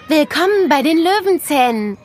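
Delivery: hohe, helle Mädchenstimme, einladend; begrüß die Kleinen wie ein stolzes Schulkind; freundliches Schulmädchen. A narrator's reading. (Mia begrüßt Nele am Schultor: hoch und freundlich)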